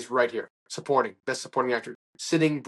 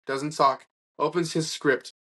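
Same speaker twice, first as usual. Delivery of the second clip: plain reading voice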